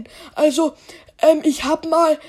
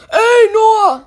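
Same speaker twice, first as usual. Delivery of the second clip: mock outrage; 6 semitones higher, 5 dB louder than usual. Friend protests being called brainless.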